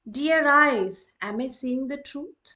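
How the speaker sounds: addressing eyes. Shown as a demonstration. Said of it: "example of apostrophe".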